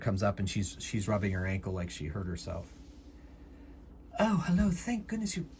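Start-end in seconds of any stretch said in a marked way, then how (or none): 4.2-5.5 much higher, put-on helpless voice; high, acted grateful greeting; relieved damsel act. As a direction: greet the guard with feigned relief. Feigns relief to the approaching guard.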